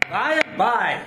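high, loud stage call; hammy stage actor. Acted. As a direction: call out toward the door. Provost calls to knocking door.

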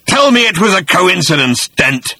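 loud, high menacing demand; demanding creature. A narrator's reading. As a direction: challenge him coldly. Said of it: "it said".

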